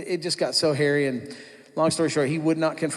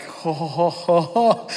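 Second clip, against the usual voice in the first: gleeful hooting, rising at the end; a touch of giddy celebration; excited whoop; 2 semitones higher, 3 dB louder, slower than usual. Retold or imitated. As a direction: whoop like you can't contain it. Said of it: "I was so excited".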